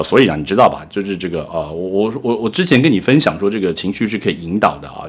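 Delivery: ordinary talk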